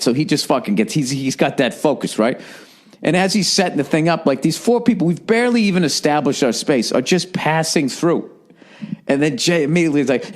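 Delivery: ordinary talk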